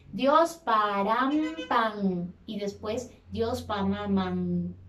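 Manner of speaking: Dios param pan y después Dios pamaman, closing the lips on each M and ending pan on a clear N; slow, with every M and N sounded; clear final consonants. Shown as a demonstration. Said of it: "la M suena con nuestros labios cerrados".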